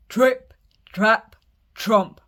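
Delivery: loud stomping hoof sounds; loud big goat thundering onto the bridge; big heavy stomp. Acted. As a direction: stomp it out heavily. Big goat stomping, troll roars.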